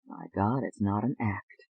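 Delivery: low, quiet thinking aloud; inward thought. A narrator's reading. David realizes privately it is real, low hushed thought. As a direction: breathe it to yourself, low and soft. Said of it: "David thought".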